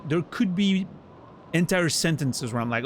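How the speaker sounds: ordinary delivery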